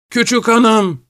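high-pitched call after someone; calling out shouting. A narrator's reading. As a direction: call out high, without full volume. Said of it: "Kulakları sağır edici bir sesle".

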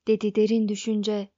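plain reading voice

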